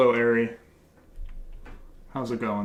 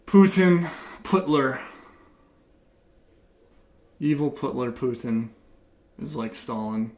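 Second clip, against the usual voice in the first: mock patriotic jeering. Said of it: "I'm saying all the things you want me to say".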